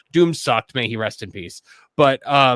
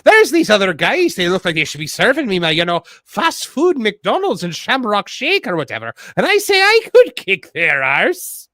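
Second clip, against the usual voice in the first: lilting put-on Irish brogue, pitched up; Irish accent; 3 semitones higher, 4 dB louder than usual. Shown as a demonstration. Host showing how Irish differs from Scottish, in an Irish accent. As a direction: read it out in a hammed-up Irish accent. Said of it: "The Irish would be like".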